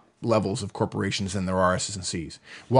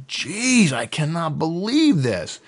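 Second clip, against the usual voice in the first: higher, slow mock exasperation; exasperated disbelief; 5 semitones higher, much slower than usual. A high I's slangy exasperation, slowed and hammed-up.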